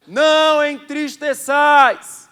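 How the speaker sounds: slow, drawn-out Não entristeçais; solemn emphasis. Acted. Pastor stressing the verse to the church, slow. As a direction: stretch each syllable.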